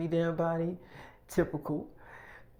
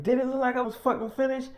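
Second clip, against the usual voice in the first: quick, higher, exasperated comeback; irritated snap; 6 semitones higher, faster than usual. Retold or imitated. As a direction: fire it back at him fast, fed up. Snapping back at Mike.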